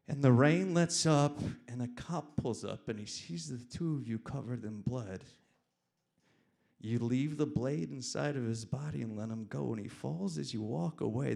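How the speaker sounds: loud, emphatic narration; loud and intense storytelling; dramatic story reading. A narrator's reading. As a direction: declaim the story with force. Reads own story forcefully.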